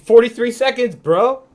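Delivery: loud, high imitation of gloating fans; mock jeering. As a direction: crow it in mock disbelief. Acting out mocking fans.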